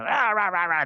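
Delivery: high growly mock-angry gibberish; mock angry ranting. Farmers asked about spraying, mock angry. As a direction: imitate farmers blowing up in wordless outrage. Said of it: "they get mad".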